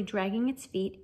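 ordinary delivery

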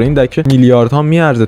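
usual speaking voice

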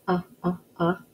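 Ah, ah, ah as a repeated short o sound; teacher sounding out the letter. Shown as a demonstration. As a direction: isolate the o sound. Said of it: "What sound do you hear".

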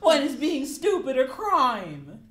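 high, loud, slow whining; hammed-up whimper. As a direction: wail it pitifully. Benson whining at interrogators.

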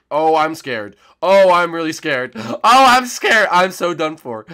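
loud, high, slow whimpered panic with a gasp; hammed-up fear. Player hiding from the killer, hammed-up loud fear. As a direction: ham up being terrified while hiding.

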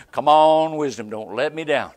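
loud, pressing plea for wisdom; urgent plea. Retold or imitated. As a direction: call out for help, loud and urgent. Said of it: "You know".

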